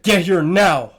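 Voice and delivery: loud, punched mock command; mock demand. Reacting to a man's needy text, loud mock demand. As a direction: belt out the order in mockery.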